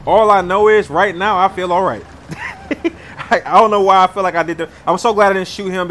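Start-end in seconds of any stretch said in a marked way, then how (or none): 2.1-3.2 relieved laugh. Real, not acted. Relieved after tense game choice.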